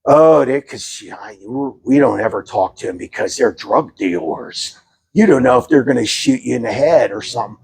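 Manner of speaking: low, subdued neighbor voice; neighbor's wife. Retold or imitated. Wife warning neighbor off drug dealers, low and wary. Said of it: "She goes".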